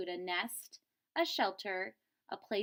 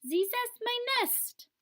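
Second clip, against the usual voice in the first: high, loud, possessive duck voice; the duck insisting the nest is hers; loud squawk of ownership; duck claiming the nest; 5 dB louder than usual. Acted. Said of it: "the duck leaped onto the goose's back".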